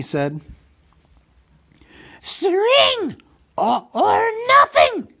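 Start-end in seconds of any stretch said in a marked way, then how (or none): 2.2-5.1 high, loud creature shriek. A narrator's reading. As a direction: shriek both guesses at once. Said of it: "shrieked Gollum".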